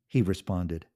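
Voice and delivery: usual speaking voice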